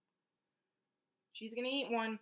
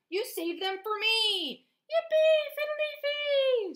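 high, stretched singsong cheer; wife's happy cry; 7 dB louder, slower than usual. A narrator's reading. Farmer's wife cheering the saved apples, overjoyed. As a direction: draw it out and leap into a squealed cheer. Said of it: "cried the farmer's wife".

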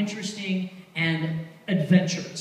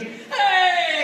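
high, loud mock-friendly yell; shouting; 11 semitones higher, 8 dB louder than usual. Pickpocket hugging the tourist, shouting. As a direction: call out a long "Hey" like the pickpocket. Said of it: "yeah, shouting and all that shit".

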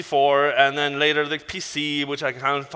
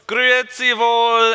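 loud, drawn-out, high Swiss German call; big slow Swiss German hello, pitched up; Swiss German greeting; 8 semitones higher, 7 dB louder, slower than usual. Shown as a demonstration. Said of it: "as we would say in Swiss German".

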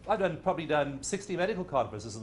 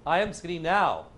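mock affirmation; 5 semitones higher, 7 dB louder than usual. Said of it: "Affirmations always work".

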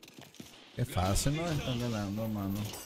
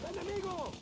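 enemy callout; 5 dB quieter than usual. Acted. Game callout, high far voice.

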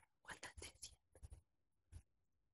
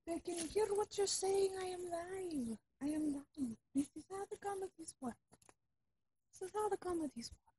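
put-on softer, hurried Russian accent plea; hushed pleading; 3 semitones higher, 8 dB quieter than usual. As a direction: hush her, others can hear. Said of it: "fake Russian spy".

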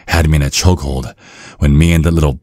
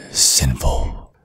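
soft unvoiced whisper; 3 semitones lower, 6 dB quieter than usual. Villain whispering to the captive priest. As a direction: purr it quietly.

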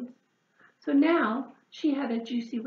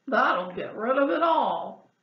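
pig voice; 6 dB louder than usual. Acted. Pig's advice.

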